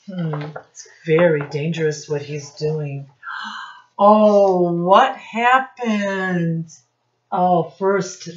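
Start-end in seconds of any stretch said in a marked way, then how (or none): 3.1-3.7 a sharp, quick storyteller's gasp; mock gasp. Teacher reacts to George falling. 4.0-6.7 loud Oh, then a slow what happened; mock surprise. Teacher reacts to George falling.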